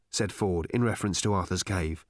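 ordinary delivery, nothing marked

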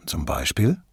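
low-pitched, mildly baffled question; genuinely puzzled. A narrator's reading. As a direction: ask in honest, understated confusion. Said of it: "aufrichtig verwirrt". (aufrichtig verwirrt, tiefer gesprochen)

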